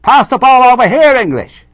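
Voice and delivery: put-on loud, high shout in an Irish accent; Irish kids' accent. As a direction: yell like an Irish kid at football. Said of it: "and they say".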